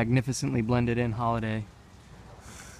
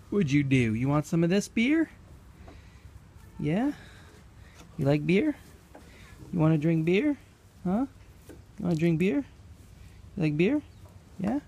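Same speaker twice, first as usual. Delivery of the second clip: pet talk; 7 semitones higher than usual. Real, not acted. Coaxing goat with beer.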